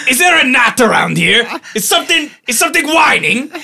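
high, loud sneering taunt; mocking taunt. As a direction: sneer like a snooty chef.

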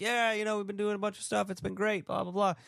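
high, breezy mock small talk; chipper high voice. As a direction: pitch it up like eager small talk. Speaker's own chatty reply retold.

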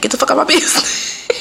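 line breaking into a laugh. Real, not acted. Podcaster cracking up at her own blunt answer, laughing.

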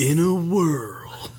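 epic trailer opening line; movie trailer narrator. Shown as a demonstration. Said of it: "You have, like, a narrator voice".

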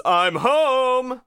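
high, big, showy cartoon entrance; Genie. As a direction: announce yourself grandly, stretching the words. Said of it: "I can kinda do that one".

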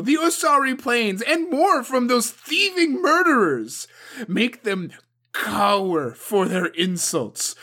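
loud, high, harsh tirade; furious demand. A narrator's reading. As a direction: lash him into action. Suko demands vengeance from champion.

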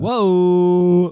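loud drawn-out whoa; surprised. Real, not acted. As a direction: react with loud mock shock. Reacting to girlfriend remark.

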